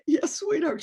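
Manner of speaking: high, sweet mothering reply; sweet mom voice. Retold or imitated. Mom answering her daughter, high sweet coo. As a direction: coo back to the little girl.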